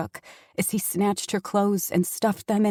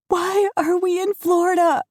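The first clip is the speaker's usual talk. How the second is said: much higher, loud desperate cry; frightened shout; 10 semitones higher, 7 dB louder than usual. Acted. Daughter shouting at father in panic. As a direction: shout it in fear.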